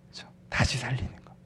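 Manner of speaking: hushed, voiceless murmur; whisper. Real, not acted. Preacher quietly explaining revival to the congregation, whispered. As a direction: say it under the breath, very softly.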